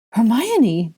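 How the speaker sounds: loud, high startled call; shouting. Acted. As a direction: shout a friend's name in alarm. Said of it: "Ginny shouted".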